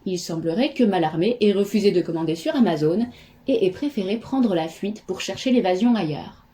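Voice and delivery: ordinary talk, nothing marked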